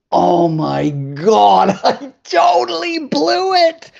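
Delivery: high, drawn-out comic wail; hammed-up anguish at blowing it; mock despair. Retold despair in the hallway.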